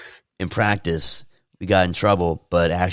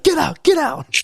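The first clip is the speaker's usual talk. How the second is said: high, loud mock yell to get out; angry coach; 5 dB louder, slower than usual. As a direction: bark him off the field in a shrill mock voice. Coach throws player out.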